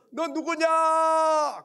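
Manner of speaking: loud, high, drawn-out shout; stretched hilltop challenge; calling out. Retold or imitated. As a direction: yell it across the valley. Child shouts across the mountain.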